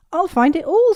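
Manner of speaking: high, loud eager promise; bursting to help. A narrator's reading.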